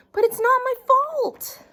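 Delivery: put-on high, pleading kid voice; whiny child protest. A narrator's reading. Noodle protesting to his mom, high and whiny. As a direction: insist it's not your fault.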